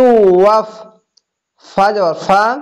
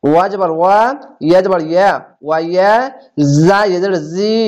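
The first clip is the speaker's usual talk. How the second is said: ordinary talk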